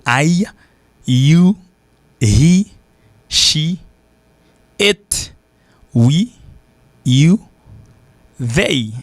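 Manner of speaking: "I, you, he, she, it" each full and separate, lifted; dictionary pronunciation. Shown as a demonstration. Teacher reciting pronouns from the book, "I" to "they" fully pronounced. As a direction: list "I, you, he, she, it, we, you, they" distinctly with pauses. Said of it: "c'est prononciation".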